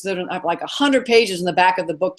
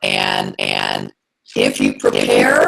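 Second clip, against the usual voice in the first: deep distorted robot voice, much lower; robot alien voice; slower than usual. Real, not acted. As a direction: voice garbled into a horrifying robot alien. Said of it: "horrifying robot alien to us right now".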